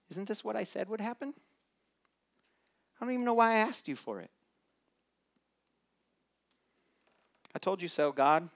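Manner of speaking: high, indignant complaining voice; whiny Jonah prayer. Retold or imitated. Jonah's prayer mimicked, high and whiny. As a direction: whine the told-you-so at God. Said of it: "sound more like Jonah's prayer".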